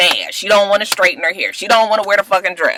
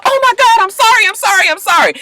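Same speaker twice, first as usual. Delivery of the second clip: high, louder gushing sorry; high panicky mock sorry; fake apologetic panic; 4 dB louder, faster than usual. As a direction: overact the apology.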